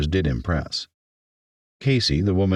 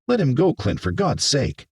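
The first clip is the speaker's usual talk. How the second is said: higher-pitched, exasperated command; exasperated order; 8 semitones higher than usual. Acted. Mayor tells Clint to release the man, exasperated. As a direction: order him to let go, exasperated.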